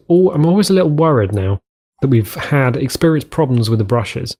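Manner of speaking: normal talking voice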